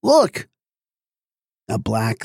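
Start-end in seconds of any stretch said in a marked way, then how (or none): 0.0-0.5 much higher, louder cry; sudden high yell; excited shout. A narrator's reading. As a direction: shout it out, pointing at the water. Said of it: "he cried excitedly".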